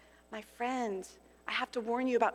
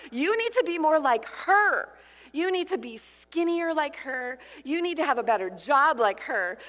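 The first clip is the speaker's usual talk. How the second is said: harsh, louder accusing voice of the enemy; accusing inner voice; 2 semitones higher, 5 dB louder than usual. Acted. Preacher voicing the enemy's lies to her congregation, harsh and accusing. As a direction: hammer the listener with shame.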